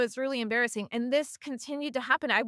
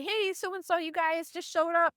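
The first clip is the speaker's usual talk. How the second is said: high, bright hostess greeting; imitated sociable hello, much higher; friendly introduction; 8 semitones higher than usual. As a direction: pitch it up to introduce a newcomer. Acting out introductions at event.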